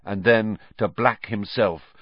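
ordinary delivery

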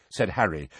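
ordinary delivery, nothing marked

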